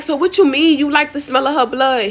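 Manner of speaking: imitating a nosy friend's disbelief; nosy, incredulous questioning. Nosy friend presses him, acted.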